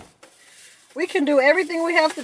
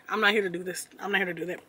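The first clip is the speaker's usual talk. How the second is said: normal talking voice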